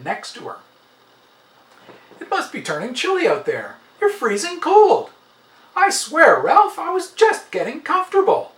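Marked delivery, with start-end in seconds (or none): 2.2-8.5 higher, louder teasing complaint; wife's playful complaint. A narrator's reading. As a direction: squeal at his freezing feet. Wife scolds cold husband.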